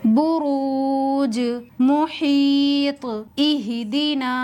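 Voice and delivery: slow, with bouncing endings on बुरूज and मुहीत; qalqala demo. Shown as a demonstration. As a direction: let the जीम of बुरूज and the त्वा of मुहीत rebound. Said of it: "ध्यान से सुनिए।".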